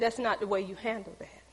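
low, soft correction; retold gentle rebuke. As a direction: imitate God quietly admonishing. God gently corrects her.

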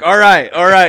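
high, loud mock surrender; retold pleading. Man in video backing away from the cat, mock pleading. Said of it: "He's like".

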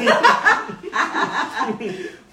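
loud, high cackling laughter; big laugh. Real, not acted. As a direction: burst out laughing. Laughs over getting heated.

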